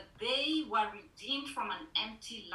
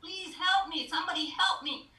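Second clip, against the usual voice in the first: high, loud desperate cry for help; shouting for help; 9 semitones higher, 7 dB louder than usual. Acted. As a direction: call out as if hanging from a cliff. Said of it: "he began to shout for help".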